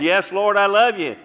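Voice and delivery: a touch of an eager Peter; Peter answering. Retold or imitated. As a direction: insist on it earnestly. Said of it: "And Peter says".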